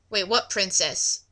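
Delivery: ordinary delivery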